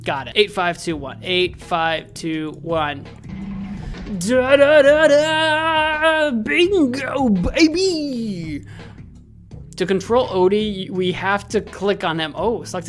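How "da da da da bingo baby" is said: triumphant fanfare. Real, not acted. Celebrates cracking the code.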